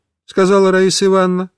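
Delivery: plain reading voice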